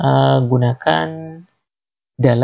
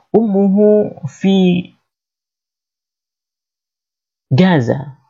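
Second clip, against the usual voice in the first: slow model answer; 3 semitones higher, much slower than usual. Shown as a demonstration. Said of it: "Di mana ibunya?".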